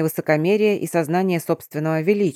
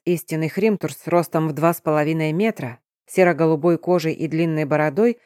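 ordinary delivery, nothing marked

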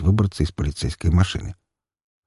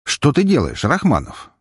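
curious; 7 semitones higher than usual. A narrator's reading. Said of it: "с нотками любопытства в голосе".

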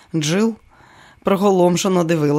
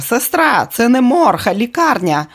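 angry director; 6 semitones higher, 3 dB louder than usual. Acted. Director yelling at actress.

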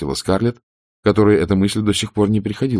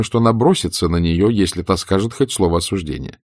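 ordinary delivery

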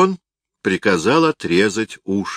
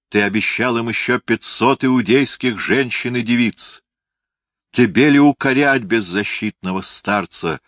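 raised, accusing narrator's tirade; indignant denunciation; 5 semitones higher, 4 dB louder than usual. A narrator's reading. Phasael denounces Antigonus for selling Mariamne, indignant. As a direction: denounce a traitor with higher, firm indignation.

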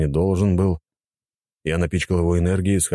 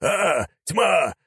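high, drawn-out zealot's cry; startled old warrior's slow battle cry; yelling; 14 semitones higher, much slower than usual. A narrator's reading. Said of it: "заорал он".

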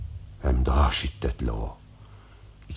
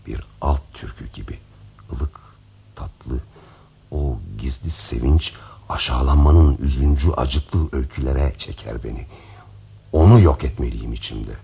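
normal talking voice

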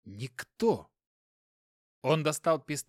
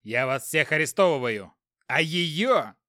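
angry shout; 6 dB louder than usual. Acted. Inspector arresting, shouting.

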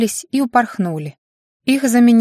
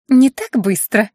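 playful warning; 4 semitones higher, 4 dB louder, slower than usual. A narrator's reading. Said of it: "шутливо погрозила".

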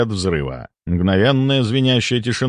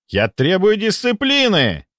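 high, slow, stubborn protest; indignant demand; 10 semitones higher, slower than usual. A narrator's reading. Deputy answers the accusing doctor, high indignant demand. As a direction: insist at a high pitch, stretching the words. Deputy shouts back in quarrel.

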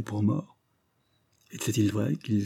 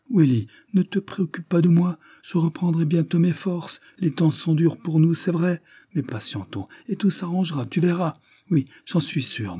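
put-on higher, imploring young woman; young wife Edith, pleading; 6 semitones higher, 3 dB louder than usual. A narrator's reading. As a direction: coax him, hopeful yet worried.

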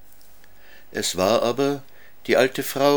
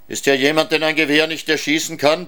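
loud, higher roar through a microphone; robot roar; 8 semitones higher, 4 dB louder than usual. Acted. Boy roaring at fleeing people through the robot's microphone, loud and high. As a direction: bellow it out over the land, higher and louder. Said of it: "brüllte der Roboter".

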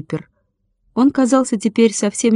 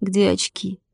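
soft, pained murmur; groaned; 6 dB quieter than usual. A narrator's reading. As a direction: groan it weakly after a hard fall. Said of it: "простонал он". (тихо простонать от боли)

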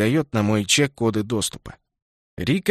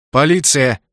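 loud police call; 2 semitones higher, 6 dB louder than usual. Acted. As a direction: call out firmly at the door. Police knock and announce.

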